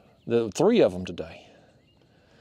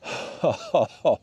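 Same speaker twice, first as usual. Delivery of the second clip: short run of happy laughter; delighted laugh. Real, not acted. Angler delighted at landing his fish, laughing. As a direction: laugh with delight over a win.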